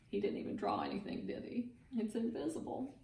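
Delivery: hushed, quick aside; quiet aside. Real, not acted. Reader's aside defending picture.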